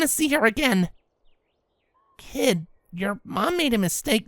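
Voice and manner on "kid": ordinary delivery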